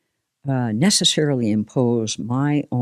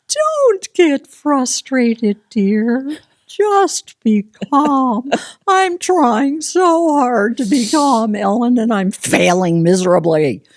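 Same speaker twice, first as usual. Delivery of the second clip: higher, louder, slow sugary put-on voice; mock soothing voice; 10 semitones higher, 5 dB louder, slower than usual. Guest hamming up soothing herself about patience. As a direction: coo it slowly like a patronizing helper. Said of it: "mock slow soothing self-voice".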